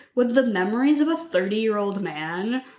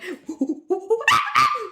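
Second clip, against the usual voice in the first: high hooting ape scream; 12 semitones higher than usual. Shown as a demonstration. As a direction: let out a wild ape scream. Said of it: "You just go like".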